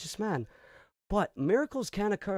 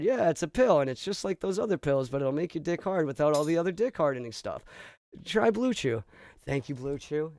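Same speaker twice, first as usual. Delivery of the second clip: booming cheesy commercial voice; loud ad read; 8 dB louder than usual. Acted. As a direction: sell it hard like a TV ad. Fake commercial break read.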